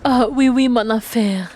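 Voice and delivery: French phrases with a put-on accent; confident French accent; French pronunciation. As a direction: play at being French. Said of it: "doing the French pronunciation".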